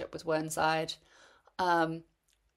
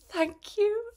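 very high, overjoyed thank you; shivering runner thanking dad for hot chocolate, squealed high; delighted thanks. Retold or imitated. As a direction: squeal your thanks for the gift. Dad hands her hot chocolate.

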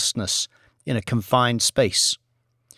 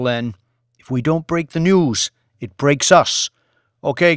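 loud, urgent newsroom boss; rally the team and send them off; McQuirk voice; 4 semitones higher, 7 dB louder than usual. A narrator's reading. Boss sends his team out to find news, loud and urgent.

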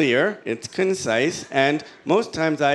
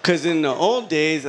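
ordinary talk, nothing marked

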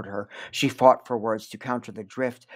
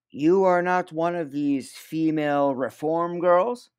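stern official; 4 semitones higher, 5 dB louder, slower than usual. Acted. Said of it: "he said finally".

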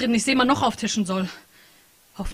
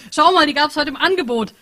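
higher and louder, proud; triumphant announcement; 7 semitones higher, 8 dB louder than usual. Acted. Henry showing off cheap energy drinks, triumphant. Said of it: "verkündete er triumphierend".

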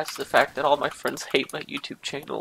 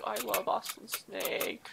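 slow, clear, a little higher declaration; spoken out; 4 semitones higher, 11 dB quieter, slower than usual. Acted. Fan openly declaring love for Austin Snake. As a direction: say it out proudly.

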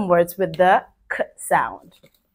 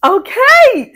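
excited okay; 7 dB louder than usual. Real, not acted. Teacher starting turn, bright.

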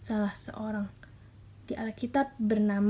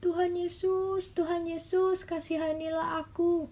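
crying out; 7 semitones higher than usual. Acted. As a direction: call out pleadingly in a raised pitch, stretching the name. (berseru memohon dengan nada tinggi)

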